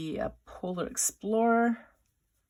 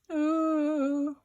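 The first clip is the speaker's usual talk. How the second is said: high, drawn-out cringing moan; embarrassed "ooh"; 8 semitones higher, 3 dB louder than usual. Acted. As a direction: wince out a long high ooh. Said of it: "an embarrassed unicorn".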